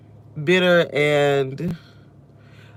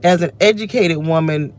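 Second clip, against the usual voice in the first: ordinary talk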